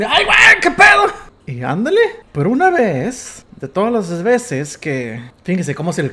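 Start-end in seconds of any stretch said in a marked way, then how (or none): 0.0-1.0 high, loud shout of fright; a sister caught off guard, yelling; startled shout. Retold or imitated. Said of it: "volteaba y".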